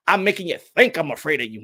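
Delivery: ordinary talk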